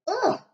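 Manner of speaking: disgusted ugh. Acted. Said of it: "she sputtered".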